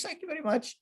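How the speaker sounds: tiny high thanks, imitating the lady; put-on grateful lady. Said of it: "I don't know why I did that voice".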